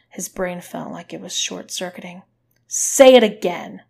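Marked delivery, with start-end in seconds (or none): 2.7-3.8 loud, high-pitched order; yelling. A narrator's reading. Woman demanding he repeat his apology, yelling. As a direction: yell the demand in their face. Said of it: "she yelled in his face".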